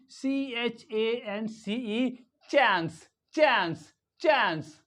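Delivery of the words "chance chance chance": CHANCE as 'chaens', front 'ae' vowel; American A. Shown as a demonstration. Teacher drilling American English, 'ae' in CHANCE. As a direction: say chance with the A of cat. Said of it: "in American English".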